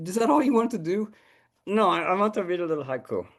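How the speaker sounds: a hint of a pushy little puppet dog. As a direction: play the cheeky puppet pup interrupting.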